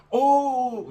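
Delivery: high, sweet delighted gasp; gushing lady. Retold or imitated. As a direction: coo like a charmed old lady. Quoting lady's delight.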